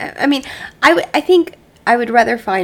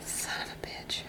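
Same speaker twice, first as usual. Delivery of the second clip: hushed mock curse; whispering; 23 dB quieter than usual. Real, not acted. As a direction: breathe it under your breath. Joking curse under breath.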